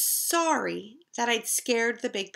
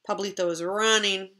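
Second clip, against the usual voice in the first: usual speaking voice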